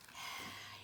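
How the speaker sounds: soft, breath-only sigh; resigned sigh. Acted. Reader bracing for silly sounds, resigned sigh. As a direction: exhale in dread of what comes.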